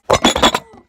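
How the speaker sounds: loud high breathless laugh; real laugh bursting out; burst of laughter. Real, not acted. Laughing fit after losing place.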